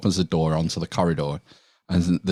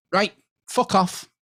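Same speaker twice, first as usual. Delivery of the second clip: dismissive dominatrix; 10 semitones higher than usual. Retold or imitated. Dominatrix dismissing the kicked client, high scornful voice. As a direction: shoo him away like dirt, pitched up. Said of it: "kicks him in the balls and goes".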